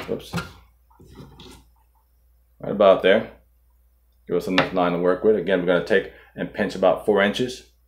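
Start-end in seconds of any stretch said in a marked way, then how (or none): none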